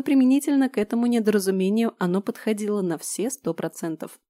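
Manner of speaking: ordinary delivery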